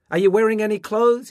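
high, very loud questioning yell; shouting. A narrator's reading. Mother checking on her undressed son from bed, shouting. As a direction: holler the question down the hall. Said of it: "his mother shouted".

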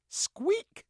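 very high mouse squeak; squeaky. A narrator's reading. Squeaky echo of name.

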